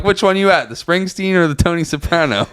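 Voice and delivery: high, playful deadpan; teasing, pretend-serious question; mock earnest question. Grandson retelling a joking question to his confused grandma, high. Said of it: "I'm like".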